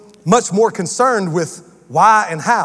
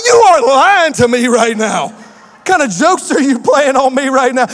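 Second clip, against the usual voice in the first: mock disbelief shout; 4 semitones higher, 5 dB louder than usual. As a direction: yell it at the drive-thru worker. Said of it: "I'm like".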